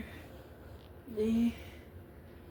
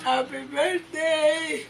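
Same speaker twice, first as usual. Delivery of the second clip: slow strained; 6 semitones higher, slower than usual. Real, not acted. Slow strained greeting, prompted.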